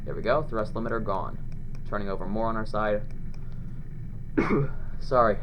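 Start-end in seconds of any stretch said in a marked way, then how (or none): 3.1-4.9 harsh dry coughing; coughing fit. Real, not acted. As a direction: cough hard, then catch your breath. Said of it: "Sorry if I keep coughing".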